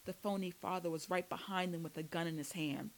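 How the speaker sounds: ordinary delivery, nothing marked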